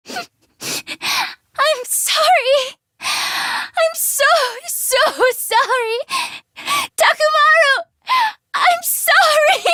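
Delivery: weeping, slow and high-pitched; breaking down over and over; sobbing apology. Acted. Girl sobs apologies to dead friend Takamaru, weeping.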